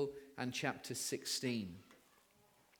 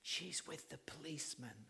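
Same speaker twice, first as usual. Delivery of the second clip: put-on barely audible child's whisper; little boy's whisper; 12 dB quieter than usual. Little boy telling the caller where mum is, whispering. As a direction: whisper it as a small child would. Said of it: "and then the little voice says".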